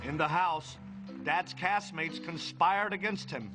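usual speaking voice

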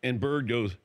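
usual speaking voice

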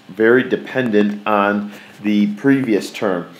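very dependent said slowly with weight on very; slow emphatic teacher stress on very. Shown as a demonstration. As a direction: lean on very and drag the phrase. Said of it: "each term is".

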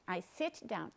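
"sit" closed on the t, no added vowel; correct consonant ending. Shown as a demonstration. Teacher gives students an example, clean final t on "sit". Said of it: "você tem que falar sit".

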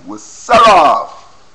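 loud, slow, drawn out sell off; emphatic shout. Real, not acted. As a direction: sell the promise hard. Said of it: "I'm telling you".

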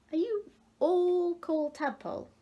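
high, wondering fish voice; puzzled little fish. A narrator's reading. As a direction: ask it with innocent surprise. Fish asks tadpoles.

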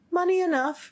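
high, confident reply; eager youth. Acted. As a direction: pipe up eagerly, pitched high. Youth eagerly answers the men.

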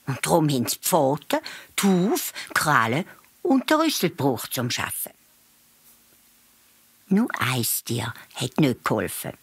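ordinary delivery, nothing marked

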